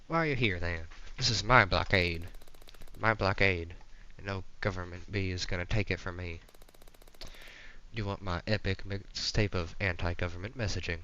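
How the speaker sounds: punchy, territorial Southern voice; Southern accent. Acted. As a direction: play a pushy Southern rebel. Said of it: "This guy's Southern now".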